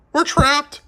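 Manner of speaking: high, loud panicked cry; frightened cry. A narrator's reading. Jessie crying out that they are trapped. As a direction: shriek it out in alarm. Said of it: "Jessie cried".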